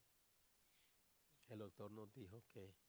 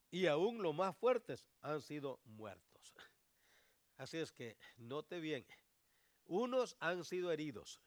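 louder, higher, slow preaching; preaching at full force; 4 semitones higher, 11 dB louder than usual. Acted. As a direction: hammer the warning home. Preacher raising his voice.

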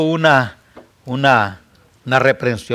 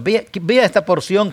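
usual speaking voice

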